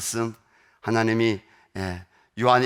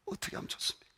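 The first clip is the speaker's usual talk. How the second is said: whispered plea; 13 dB quieter, faster than usual. Retold or imitated. Prayer ends in broken whisper.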